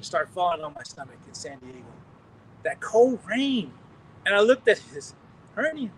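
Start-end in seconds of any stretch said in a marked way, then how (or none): 2.6-3.7 drawn out, each word slow and heavy; slow emphasis. Real, not acted. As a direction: linger on cold rain, almost savoring it. Emphasizing the sensation.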